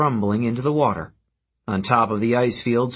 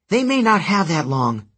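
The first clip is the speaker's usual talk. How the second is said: warning; 7 semitones higher than usual. Acted. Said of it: "the girl warned".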